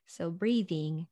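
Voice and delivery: normal talking voice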